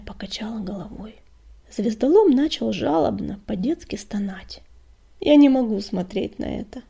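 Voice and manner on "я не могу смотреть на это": shouting. Acted. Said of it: "крикнула Щербатая".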